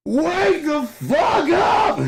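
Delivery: long, high full-force scream; screamed outburst. Real, not acted. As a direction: scream it to wake everyone. Screaming rant at the world.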